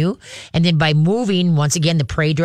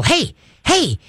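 high, sharp "Hey, hey" to grab attention; sharp attention call; 10 semitones higher than usual. Shown as a demonstration. Trainer popping the leash, high sharp call to the dog. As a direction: snap the dog's focus back. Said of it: "give the leash a pop, and go".

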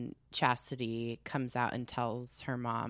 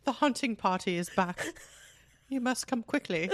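snobbish British; 8 semitones higher than usual. Pretend. Butler role continues.

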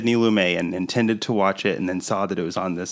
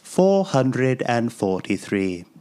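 put-on British accent. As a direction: read the blurb like an elderly Englishman. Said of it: "Very good accent".

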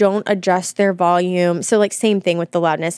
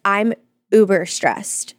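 slow, drawn-out "uber stressed"; imitated emphasis on UBER; much slower than usual. Teasing co-host with her own pet word, slow emphasis on uber. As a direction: mimic the habit, stretching "uber stressed" slowly. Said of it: "And you're like".

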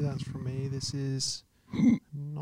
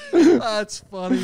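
laughing; 6 semitones higher, 7 dB louder, much slower than usual. Real, not acted. Reacts to prank reveal.

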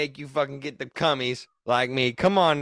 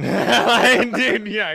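laughing; 9 semitones higher, 8 dB louder, much slower than usual. Real, not acted. Laughing while bragging.

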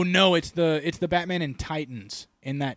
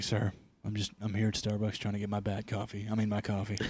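low quiet Batman; 4 semitones lower, 9 dB quieter than usual. Retold or imitated. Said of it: "He's like".

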